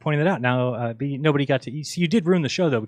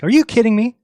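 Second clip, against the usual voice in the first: loud high disbelief; outraged are you kidding me; incredulous; 10 semitones higher, 8 dB louder than usual. Retold or imitated. Incredulous retort.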